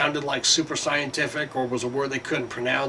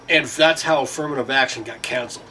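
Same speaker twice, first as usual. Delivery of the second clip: ordinary delivery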